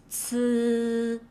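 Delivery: ts buzzed with vocal cords; voiced ts. Shown as a demonstration. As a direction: make ts vibrate. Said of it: "Voice it".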